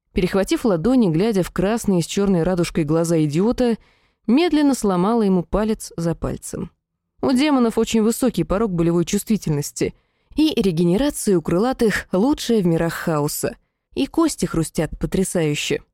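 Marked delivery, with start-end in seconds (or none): none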